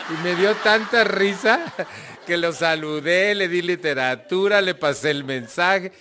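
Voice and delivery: high, loud, animated telling; amused excitement. Real, not acted. Speaker telling the room how he met the president, loud amusement. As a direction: raise the voice over the joke, really tickled. Tells of his laughing fit, excited.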